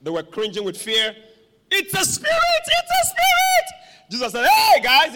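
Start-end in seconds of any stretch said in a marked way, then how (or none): 1.7-4.1 very high, loud panicked shriek; hammed-up terror. Preacher acting disciples cringing with fear, mock terrified shriek. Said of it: "the disciples were afraid". 4.5-5.1 loud, high hail; calling out. Acted. Preacher as Jesus hailing frightened disciples, calling out.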